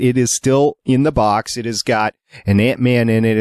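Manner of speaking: normal talking voice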